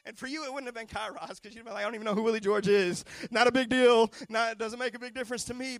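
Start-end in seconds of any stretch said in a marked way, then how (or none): 1.8-5.8 louder, shrugging, dismissive mock voice; mock indifferent. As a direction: brush it off like it's nothing. Imagined listener shrugging it off.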